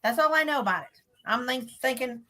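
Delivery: normal talking voice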